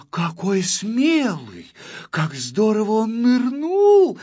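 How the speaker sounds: high, drawn-out gushing admiration; admiring. A narrator's reading. Girl admires the brave diver, gushing. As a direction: marvel slowly in a high, swooning voice. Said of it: "восхищалась Кнопочка".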